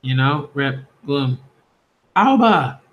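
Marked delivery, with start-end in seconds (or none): 2.1-2.8 higher, louder cry of a name; sharp call. Acted. Calling out to Alba as the book is eaten, sharp high call. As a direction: call out the name, pitched up and loud. Shouting at a child in the story.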